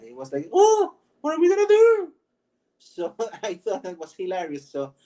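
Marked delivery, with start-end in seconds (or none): 0.5-2.1 very high, loud theatrical Frenchman; flamboyant French character. Acted. Demonstrating the game character's voice, loud flamboyant falsetto. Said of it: "guy's voice was like that".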